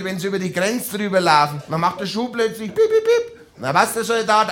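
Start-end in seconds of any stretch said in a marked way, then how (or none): 2.9-3.4 high mock beeps; high beeping. Soldier's boots warn with high beeps. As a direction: chirp it like a machine. Imitating a beep.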